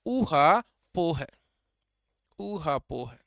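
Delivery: ऊहापोह stretched slowly with a long ऊ; slow drawn-out ऊ. Shown as a demonstration. Teacher models the word slowly for students, drawn out. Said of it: "ऐसे कैसे भी जोड़ के इनको ध्यान रखना".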